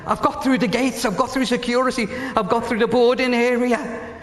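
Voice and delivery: high, excited rush of progress; retold excitement. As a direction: build excitedly, pitched well up. Retelling a woman's excited progress report.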